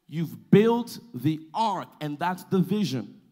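drawn-out words with weighty pauses; slow down so every word lands; slow, deliberate preaching. Real, not acted. Preacher speaking slow so congregation gets it, slow deliberate preaching. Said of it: "I'm speaking slow".

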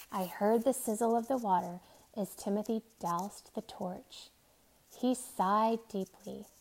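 ordinary delivery

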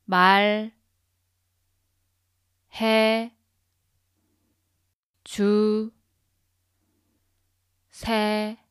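다 시 말 해 주 세 요 one syllable at a time with long gaps; sounding out syllables. Shown as a demonstration. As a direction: split 다시 말해주세요 into separate syllables. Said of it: "break it into syllables so that you can repeat after me".

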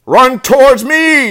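high, slow, loud holler; gander's yell. A narrator's reading. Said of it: "yelled the gander".

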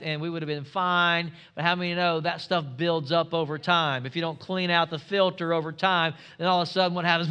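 ordinary delivery

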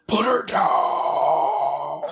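strained, drawn-out growling roar; Batman. Retold or imitated. Co-host mocking Batman's straining growl, aggressive drawn-out roar. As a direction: growl it out slowly, straining hard. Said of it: "he gave Batman a deeper, more aggressive voice".

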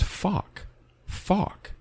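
high, breathy mock Maine "Fuck" for fork; Maine accent fork. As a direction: imitate the Maine man saying "Fuck" meaning fork, pitched high. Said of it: "what he's saying is".

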